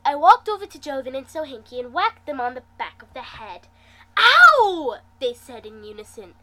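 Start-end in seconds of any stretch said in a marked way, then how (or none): 4.2-5.0 high, loud mock "Ow!"; yelp of pain. Joven and Sohinki slapped on the head, loud yelp.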